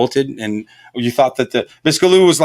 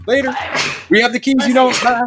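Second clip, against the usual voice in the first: mocking cops; 9 semitones higher than usual. Cops locking everyone in.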